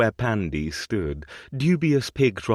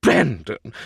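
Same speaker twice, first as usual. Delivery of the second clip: louder, shocked reproach; scandalized cry; 5 semitones higher, 7 dB louder than usual. A narrator's reading. Grandmother reproaches her grandson, loud scandalized cry. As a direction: call him out for indecency. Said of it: "her tone scandalized".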